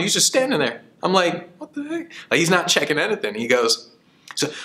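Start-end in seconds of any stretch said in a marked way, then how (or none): 1.6-2.1 baffled aside. Retold or imitated. Narrator's own puzzled thought.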